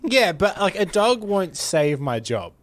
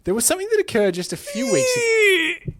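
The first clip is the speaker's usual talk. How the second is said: high-pitched, laughing through words; can't hold back the laughter. Real, not acted. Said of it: "I'm sorry".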